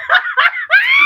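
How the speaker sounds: loud, high burst of laughter. Real, not acted. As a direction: cackle at your own joke. Laughing at own joke.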